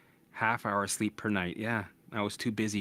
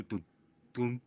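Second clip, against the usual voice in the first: ordinary delivery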